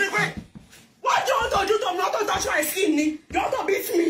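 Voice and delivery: mock alarm. Husband protests, raised voice.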